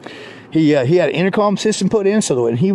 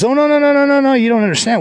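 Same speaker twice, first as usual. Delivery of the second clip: much higher, louder, fast string of no's; eager protest; 11 semitones higher, 4 dB louder, faster than usual. Retold or imitated. The hired man correcting the storyteller, high, loud and fast. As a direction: rush to set him straight.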